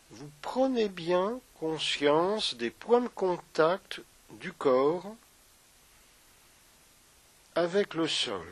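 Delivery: unhurried meditation guide with long pauses; guided meditation. Acted. Yoga teacher guiding listeners through a body scan, guided meditation.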